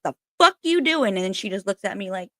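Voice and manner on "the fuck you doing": high, loud angry snap; retold road rage. Yelling from her car at a driver who nearly hit her, furious. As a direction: yell at the driver who cut in. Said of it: "and I was like".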